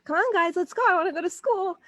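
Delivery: put-on high, whiny kid voice; impatient little brother. Brother eager to leave for school, high whiny plea. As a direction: play the brother begging everyone to hurry. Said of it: "He's like".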